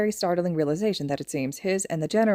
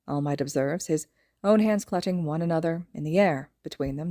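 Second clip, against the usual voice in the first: ordinary delivery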